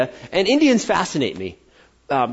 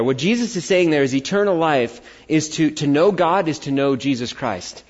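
ordinary delivery

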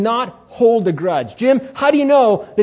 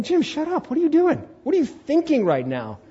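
self-scolding; 8 semitones higher than usual. Retold or imitated. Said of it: "It's like".

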